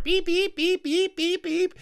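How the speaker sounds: very high repeated peeping calls; spring peeper imitation. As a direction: chirp them out like tiny frogs. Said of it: "the spring peepers going".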